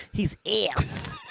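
imitating a fan's accent, vowel drawn out; mock accented put-down; Philly five hundred. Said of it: "You gotta say it with his accent".

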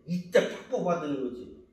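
usual speaking voice

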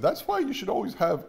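high, lightly imitated Julia Child accent; a quick playful nod to Julia Child's accent; Julia Child impression. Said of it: "you know, in her accent".

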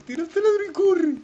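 high panicked shout; panicked yell. Real, not acted. Urgent yell to friend.

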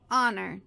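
"Honor" begun on the vowel, no H; silent H. Shown as a demonstration. Teacher shows phonetic transcription, silent H in "Honor". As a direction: say "Honor" without sounding its H. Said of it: "também o H não está".

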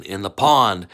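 plain reading voice

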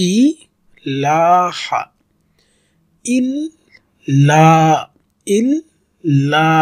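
ইল্লা with the doubled ল held, very slow; slow tajweed chant; drawn recitation. Shown as a demonstration. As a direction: lean on the ল্ল in ইল্লা and stretch it. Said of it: "তাসদিদ এবং জবর".

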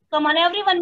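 ordinary talk, nothing marked

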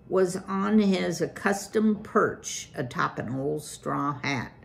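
plain reading voice

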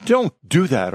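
high, mildly urgent cry; lightly voiced protesting woman. A narrator's reading. Woman stops a man hurting the wolves, crying out mildly. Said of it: "a woman cried".

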